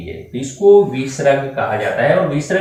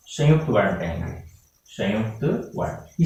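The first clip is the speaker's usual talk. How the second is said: संयुक्त वर्ण, a gentle classroom echo; soft repetition; 4 semitones lower, 7 dB quieter than usual. Shown as a demonstration. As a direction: let the term sink in, softly. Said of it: "क्या कहेंगे इनको हम?".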